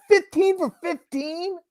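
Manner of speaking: high, slow incredulous coach; squeaky, drawn-out mock shock; mock coach disbelief. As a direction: play a coach who can't believe it. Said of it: "They go in there like".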